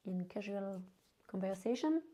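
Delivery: ordinary talk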